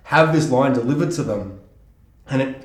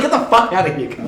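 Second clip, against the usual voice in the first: mock dismissal; 3 dB louder than usual. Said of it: "He's like".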